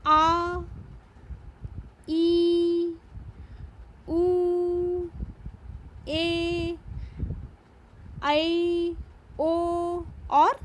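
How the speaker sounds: teacher holding long vowels for the class; drawn-out long vowels. Shown as a demonstration. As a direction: stretch every vowel like a teacher showing length. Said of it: "जैसे".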